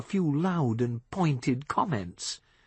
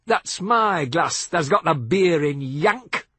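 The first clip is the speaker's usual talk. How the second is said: louder, higher belligerent local; drunken challenge; 5 semitones higher, 5 dB louder than usual. A narrator's reading. Drunk Seth confronting Billy Joe over the glass, belligerent. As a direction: square up to him.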